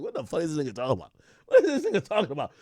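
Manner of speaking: fast, laughing through the question; laughing disbelief. Retold or imitated. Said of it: "I'm like".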